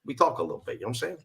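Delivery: easygoing New York wise guy; New York accent. Shown as a demonstration. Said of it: "I do also do the New York accent".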